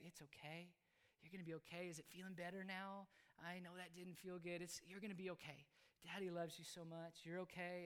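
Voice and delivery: soft, hushed fatherly reassurance; soothing comfort. Retold or imitated. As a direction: gently soothe a crying child. Comforting his crying child.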